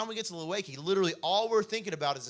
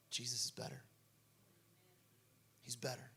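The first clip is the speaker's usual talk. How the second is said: much softer, lower, after the shouting; hushed answer; 5 semitones lower, 12 dB quieter than usual. Acted. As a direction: drop right down to a quiet certainty. Sudden drop after preaching climax.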